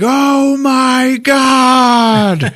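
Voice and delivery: long wailing oh my God; shocked shout. Acted. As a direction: stretch it out in horror like a hero stepping in muck. Thor reacts, stepping in puddle.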